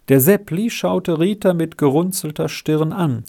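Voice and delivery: ordinary delivery, nothing marked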